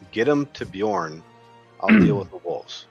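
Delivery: half-hearted yell, lifting on the second sentence; yelled order. Acted. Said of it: "I'm gonna yell out".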